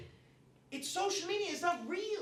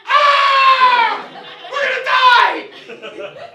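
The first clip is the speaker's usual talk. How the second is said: much higher, much louder mock panic scream; panicked conservative; 26 dB louder, much slower than usual. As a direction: shriek like the world is ending. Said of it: "this is my impression of a conservative".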